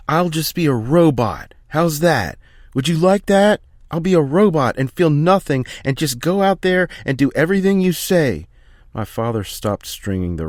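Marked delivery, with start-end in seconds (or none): 0.1-8.5 much higher, much louder bitter shouting; angry shout. Acted. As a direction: hurl the robot taunt at your father. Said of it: "Philly started panting with anger".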